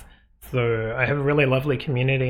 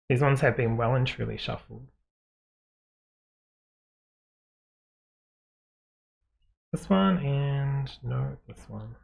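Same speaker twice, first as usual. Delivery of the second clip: muffled, as if through a hand. Real, not acted. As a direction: speak muffled, covered mouth. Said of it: "Sorry if I'm sounding a little bit muffled".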